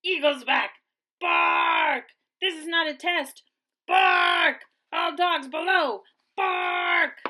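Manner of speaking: loud urgent warning shouts; alarm shout. A narrator's reading. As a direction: sound the alarm to all below. Watchdog warns of the eagle.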